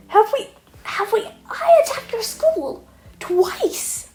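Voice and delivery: high, loud, slow outraged whine; indignant villain. Acted. Shigaraki outraged that Midoriya forgot him, high and loud. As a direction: Shigaraki squeals in offended disbelief.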